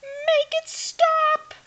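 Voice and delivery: very high, loud, slow panicked yell. A narrator's reading. Hildy begs the vacuum to stop, high loud yell. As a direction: wail for it to stop. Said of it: "yelled Hildy".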